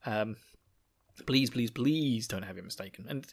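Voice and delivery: usual speaking voice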